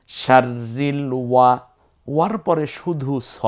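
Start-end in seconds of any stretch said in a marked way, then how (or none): 0.1-1.6 শাররিল ওয়া slow and evenly pitched, held r; model recitation. Shown as a demonstration. Teacher adding a syllable, slow recited শাররিল ওয়া. Teacher demonstrates the built word.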